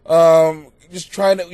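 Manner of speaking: ordinary talk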